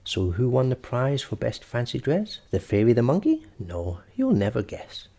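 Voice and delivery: plain reading voice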